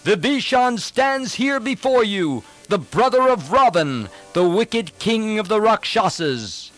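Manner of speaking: high, projected proclamation; clear, calm courage. A narrator's reading. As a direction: call out boldly and clearly from above. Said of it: "spoke out in a clear voice".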